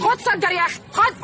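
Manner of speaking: loud, high furious order; angry command. Acted. Nani forcing Hussein to take a drag, furious shout. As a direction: shove the words at him in rage. Nani shoves cigarette at him.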